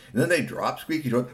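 plain reading voice

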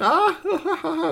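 high squealing baby giggle, acted; baby laughing. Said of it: "Buster laughed and laughed".

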